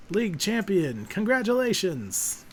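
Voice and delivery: slow, grand congratulations; drawn-out congratulation. Acted. Professor crowning the new champion, slow and grand. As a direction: savour the word like a proud elder.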